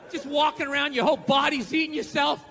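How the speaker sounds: ordinary talk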